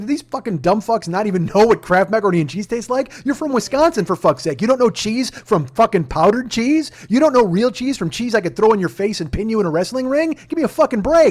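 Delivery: loud, high, incredulous rant; worked-up mock tirade about fake cheese; exasperated rant. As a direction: rant like you can't believe these people. Ranting retelling of his outburst.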